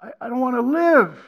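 builds from a plea to a loud, high, anguished cry; retold anguished prayer climbing to a loud high cry; desperate cry to God. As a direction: beg God to make it stop, rising to a shout. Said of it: "I cried out".

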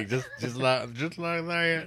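put-on high-pitched silly German accent; mock German accent. Co-hosts riffing on Colonel Klink, mock German accent. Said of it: "My favorite German accent".

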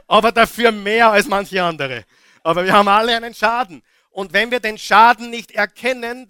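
loud, high, fast fervent preaching; preaching at full force. Acted. Preacher insisting to the church that all are broken, preaching loudly. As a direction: shout the message home to the congregation.

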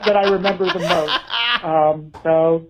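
ordinary talk

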